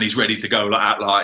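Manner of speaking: ordinary talk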